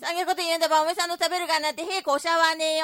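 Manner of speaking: quick, lightly acted scolding; threatening wife. Wife threatens to stop cooking for husband, scolding. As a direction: warn him in a wifely huff.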